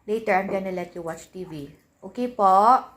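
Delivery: soft, patient coaxing for a little one; gentle mom voice. Real, not acted. Mother coaxing her daughter to go play instead.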